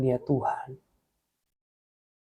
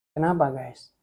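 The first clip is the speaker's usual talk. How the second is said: ordinary talk, nothing marked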